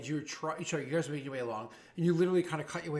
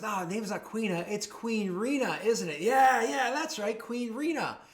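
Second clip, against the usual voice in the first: high, loud, drawn-out mocking taunt; mock her title with swelling glee; jeering; 6 semitones higher, 5 dB louder, slower than usual. Second thug mocks Rena as a queen, jeering. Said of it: "almost jeeringly and says".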